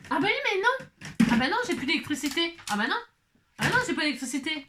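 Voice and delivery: high, startled "oh no"; surprised dismay. Real, not acted. Power cut mid-video.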